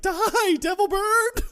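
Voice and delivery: very high, loud comic shriek; hammed-up panicked attack yell; mock battle cry. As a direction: scream it wildly at an unseen attacker. Acting Ace hurling torch at squeak.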